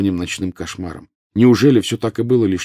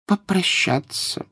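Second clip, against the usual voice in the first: high, slow female character voice; woman professor finishing gently; 13 semitones higher, much slower than usual. A narrator's reading. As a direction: finish the thought high and drawn out. Sprout finishes Harry's sentence.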